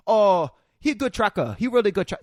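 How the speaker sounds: put-on high, broken-English Karen rebel accent; imitated Karen rebel accent. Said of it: "what he sounded like".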